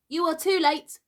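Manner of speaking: put-on high, loud boyish boast; proud cry. A narrator's reading. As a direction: crow it like a conqueror. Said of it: "he cried proudly".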